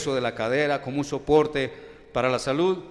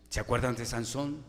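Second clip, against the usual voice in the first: usual speaking voice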